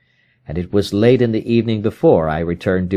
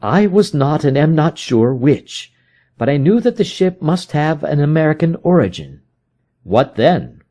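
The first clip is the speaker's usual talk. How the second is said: higher, brisk detective voice; Holmes, explaining his search; 6 semitones higher than usual. Acted. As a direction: lay out the trail.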